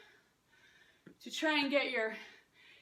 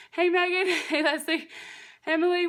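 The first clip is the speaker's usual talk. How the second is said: calling out hello; 2 semitones higher, 7 dB louder than usual. Real, not acted. Greeting friends joining live.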